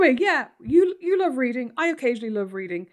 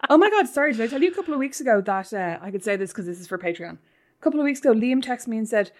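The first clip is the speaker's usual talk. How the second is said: normal talking voice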